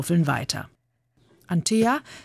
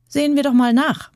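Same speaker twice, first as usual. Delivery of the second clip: put-on louder, higher boyish call; 9 semitones higher, 5 dB louder than usual. Kyrill daring the others before jumping in, calling out. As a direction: dare the others, voice raised. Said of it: "rief Kyrill".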